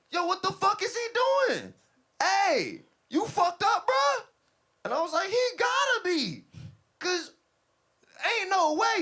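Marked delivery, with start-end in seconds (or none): 0.1-4.2 high, loud disbelief; witness's outraged shouting. Retold or imitated. 5.3-9.0 high, loud agreement in disbelief; incredulous shouting. Retold or imitated. Acts out his shouted reply.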